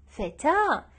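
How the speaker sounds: usual speaking voice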